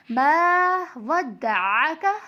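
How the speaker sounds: slow "मा वद्दअका", long "मा", the अ squeezed from the throat; throaty ain. Shown as a demonstration. Teacher demonstrates the letter ain, throat-made अ in "वद्दअका". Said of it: "इसकी आवाज़ गले से निकालते हैं".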